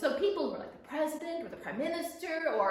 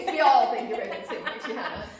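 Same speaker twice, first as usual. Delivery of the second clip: laughing praise; 9 dB louder, much faster than usual. Real, not acted.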